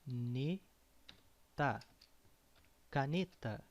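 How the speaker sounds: Caneta stretched out slowly, syllable by syllable; drawn-out teaching model of Caneta; slow Portuguese word. Shown as a demonstration. Teacher models a Portuguese word for learners, slow and clear. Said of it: "That means pencil".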